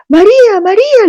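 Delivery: high, loud call across the yard; shouting a name. Retold or imitated. Landlady calls the neighbour's name, shouting. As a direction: yell her name to get her attention. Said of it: "le grita".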